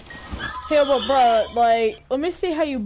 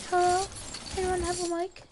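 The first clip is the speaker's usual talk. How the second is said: put-on man doing a higher girl voice; 7 semitones higher, 7 dB quieter, faster than usual. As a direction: pitch up to pass as a girl. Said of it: "Is this a good girl voice?".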